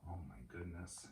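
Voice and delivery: plain reading voice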